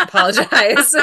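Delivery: giggling through an apology; laughing it off, embarrassed. Real, not acted. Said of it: "Excuse me".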